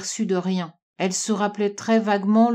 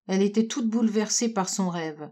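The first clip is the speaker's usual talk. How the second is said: plain reading voice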